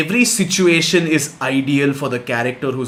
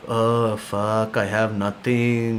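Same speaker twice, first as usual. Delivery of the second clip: mopey groan; 5 semitones lower, much slower than usual. Retold or imitated. Himself broke at game's end.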